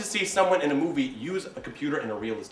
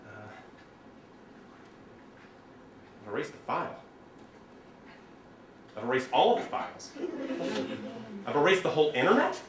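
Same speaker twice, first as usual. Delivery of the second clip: mock technophobe panic; 4 semitones lower, 9 dB quieter than usual. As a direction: play the lost computer novice, half-whispered. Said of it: "the ones who are going like".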